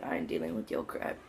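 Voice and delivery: low, soft dismissive brush-off. Retold or imitated. Retelling how he brushed off the girl, low dismissive mutter. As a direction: drop it low and shrug her off.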